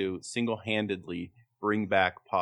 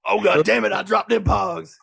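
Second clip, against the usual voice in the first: loud, high cursing holler; hammed-up angry shout; mock exasperated outburst; 10 semitones higher, 7 dB louder than usual. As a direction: yell it like a clumsy rapper cursing himself. Acting out [name] dropping his Pogs.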